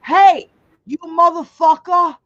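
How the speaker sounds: loud imitation of a Boston tough guy's accent. Imitating Boston Brian scolding trolls, loud Boston accent. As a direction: bark it out in a mock Boston accent. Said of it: "he's got his Boston accent".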